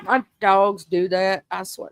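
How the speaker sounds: ordinary talk, nothing marked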